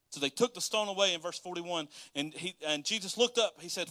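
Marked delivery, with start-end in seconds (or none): none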